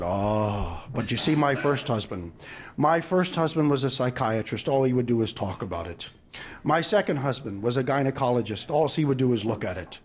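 low-pitched, resigned comic confession; mock world-weary. Bride explains her husbands to shop lady, mock resigned. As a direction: explain it with an acted sigh of disappointment, well down in pitch.

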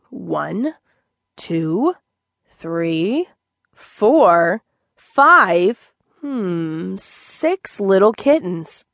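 slow, wondering count with rising numbers; proud father cat counting. Acted. Gray tomcat Tay counts his newborn kittens, slow and proud. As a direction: count each kitten in delight, slowly.

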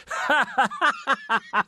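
high scornful cackle; mocking laughter. Papa and uncle Adolf mocking the lawyer, high scornful laughter. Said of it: "Hohngelächter".

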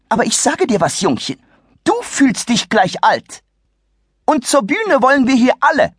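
haughty shrieky matron; indignant old lady. Acted. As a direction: scold the rude youth. Lady scolds the boy.

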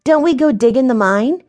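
put-on high, questioning child's voice; puzzled girl. Violet not understanding about the mine, puzzled. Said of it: "Violet didn't understand".

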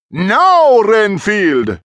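loud, high, commanding call. A narrator's reading. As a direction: summon your servant with a ringing shout. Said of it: "he called".

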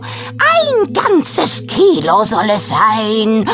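hoarse, loud witch incantation. Acted. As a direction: call out the spell hoarsely and slowly. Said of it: "ruft mit heiserer Stimme".

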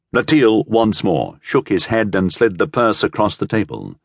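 ordinary delivery

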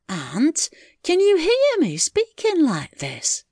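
high, slow, astonished question; gasping. Acted. Mary amazed her deaf aunt hears, gasping. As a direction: ask it in amazement. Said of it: "Mary gasped".

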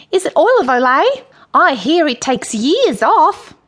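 high, loud flattering character voice; fawning Michael. A narrator's reading. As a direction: gush like an eager admirer. Michael fawns over queen's looks.

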